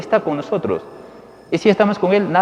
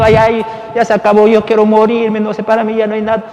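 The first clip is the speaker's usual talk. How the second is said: mock crying lament; 8 semitones higher, 6 dB louder than usual. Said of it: "no estar llorando".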